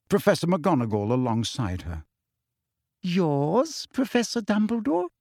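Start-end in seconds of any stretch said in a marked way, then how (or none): 3.0-5.1 sneering Umbridge, higher and rising; mock disbelief with a nasty edge; unpleasant mocking question. A narrator's reading. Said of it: "with a singularly unpleasant little laugh".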